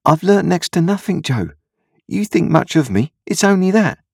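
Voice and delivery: put-on high boyish voice; self-doubting young Pip. A narrator's reading. Pip dejectedly disagrees.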